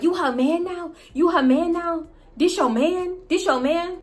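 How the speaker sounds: mocking Alicia. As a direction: needle her with a high-pitched sneer. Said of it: "So Alicia was like".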